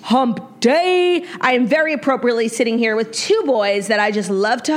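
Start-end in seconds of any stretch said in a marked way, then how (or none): 0.0-1.2 drawn-out singsong announcement; show opening greeting. Real, not acted. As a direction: stretch it out, pitched up.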